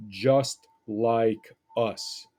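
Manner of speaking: "just like us" drawn out slowly, each word weighted; slow emphatic phrase. Shown as a demonstration. Teacher reading the essay's punchline, slow and emphatic. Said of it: "short part for emphasis".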